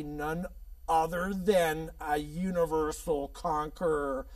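slow, swelling announcer build-up; hammy, slow showman's build; dramatic guest introduction. Acted. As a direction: draw out each phrase like a ringside announcer.

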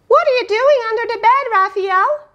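high, quick surprised question; curious parent calling. Acted. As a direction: voice the parent peeking under the bed. Voicing a parent in story.

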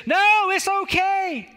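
high, drawn-out call back; slow acted holler of refusal; shouting back. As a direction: call it back across the water, stretching the words. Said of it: "shouted back".

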